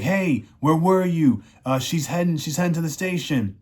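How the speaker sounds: a hint of an eager young kid, quick at the end. Young kid reports to Ben.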